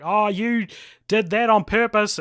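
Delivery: mock angry angel. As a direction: retell the angel's angry accusation. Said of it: "the angel gets real mad".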